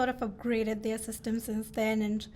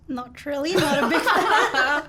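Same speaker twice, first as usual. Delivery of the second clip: laughing; 6 semitones higher, 9 dB louder than usual. Real, not acted. Joking about disliking humans, laughing.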